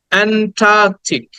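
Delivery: slow "অ্যান্টার্কটিক" with "টা" stretched long; drawn-out vowel. Shown as a demonstration. Teacher showing the long sound in Antarctic, drawn-out "টা". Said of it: "একটু টেনে বলতে হয়".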